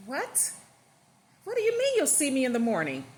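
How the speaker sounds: can't believe what she just heard; stunned outrage. Acted. Wife hearing husband is leaving, stunned and angry.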